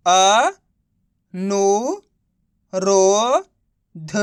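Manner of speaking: अ, नु, रो, ध each held long and set apart; spelling out syllables. Shown as a demonstration. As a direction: sound out अ, नु, रो, ध with clear gaps. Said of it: "एक एक अक्षर का उच्चारण सही से". (अ, नु, रो, ध को अलग-अलग खींचकर बोलना)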